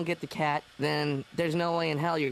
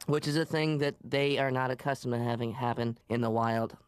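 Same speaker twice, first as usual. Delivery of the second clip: ordinary talk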